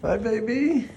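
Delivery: ordinary talk, nothing marked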